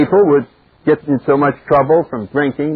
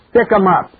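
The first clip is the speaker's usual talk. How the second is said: higher-pitched stick-up man demand; 6 semitones higher than usual. Acted. Stick-up man confronts Jack Benny on radio, commanding. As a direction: call it out like a radio robber. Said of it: "this stick-up man's voice".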